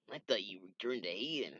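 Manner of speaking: usual speaking voice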